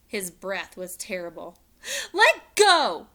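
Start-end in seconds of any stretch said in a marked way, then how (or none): 2.1-3.1 high loud yell in his face; angry shout. A narrator's reading. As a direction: shout it right at him. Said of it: "Annie shouted into his face".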